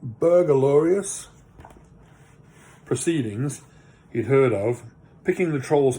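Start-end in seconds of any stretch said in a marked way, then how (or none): 0.0-1.3 Burglarious said slowly, syllable by syllable, BUR-GLA-RI-OUS; careful pronunciation. Shown as a demonstration. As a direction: sound out Burglarious slowly and with care. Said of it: "how do you say this word?".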